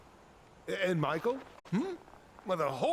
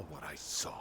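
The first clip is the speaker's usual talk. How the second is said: whispering; 12 semitones lower, 8 dB quieter than usual. Acted. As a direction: hiss it quietly, secretive. Secretive threat to rival.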